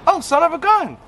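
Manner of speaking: delighted surprise at the news; surprised exclaim. Retold or imitated. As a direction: gasp it out in happy surprise. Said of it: "we're like".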